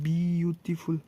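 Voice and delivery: Beautiful slowly sounded out in separate syllables; teacher's slow syllable count on beautiful. Shown as a demonstration. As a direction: stretch each syllable of beautiful. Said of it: "चार तो है तो syllable".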